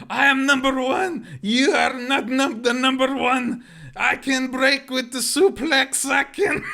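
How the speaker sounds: high, loud, slow broken-English wrestler boast; Iron Sheik impression. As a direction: bellow it like a cartoon wrestling villain, slowly. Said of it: "act all Iron Sheik".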